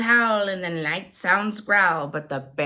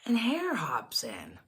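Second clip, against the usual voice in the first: whisper; 4 semitones lower, 6 dB quieter than usual. A narrator's reading. Hushed narration.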